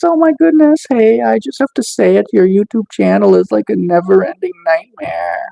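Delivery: high-pitched mock horror; exaggerated dismay, starting high and easing down. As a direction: put on a scandalized high voice. Mocks a nasty chat comment.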